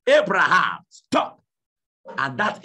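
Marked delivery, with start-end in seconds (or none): 0.1-1.4 loud commanding shout of "Stop, Abraham"; voice from heaven shouting. Acted. The voice from heaven stopping Abraham, loud commanding shout. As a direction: thunder it down as the voice of heaven. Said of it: "the voice speak from heaven and said".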